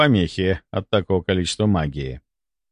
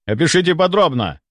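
higher, firm command; ordering; 6 semitones higher than usual. A narrator's reading. Captain questioning the man with the vision, ordering. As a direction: demand a full report. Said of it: "приказал капитан".

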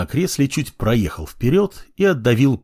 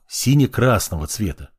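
usual speaking voice

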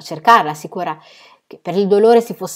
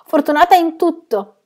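high, loud, out of breath from jumping; breathless reply; 10 semitones higher, 7 dB louder than usual. Acted. Aunt answering her husband breathless from jumping. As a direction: pant it out, still elated. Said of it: "rispose lei ansimante".

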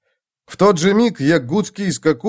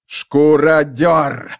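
drawn-out roar, louder and hollow; 2 semitones higher, 4 dB louder, much slower than usual. Acted. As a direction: bellow the name across the battlefield. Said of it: "заревел он".

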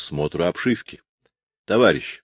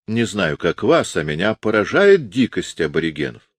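plain reading voice

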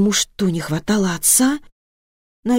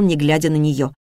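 ordinary delivery, nothing marked

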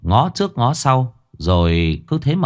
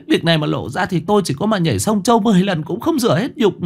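higher, rushing, pleading woman; frantic pleading widow, climbing higher; desperate pleading; 7 semitones higher, faster than usual. A narrator's reading. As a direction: beg him, rising in panic.

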